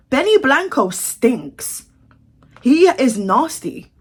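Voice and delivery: each word drawn out slowly and pressed; slow emphatic verdict. Real, not acted. To viewers about [name], slow and emphatic.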